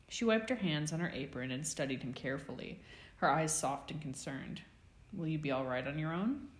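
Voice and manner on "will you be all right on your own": usual speaking voice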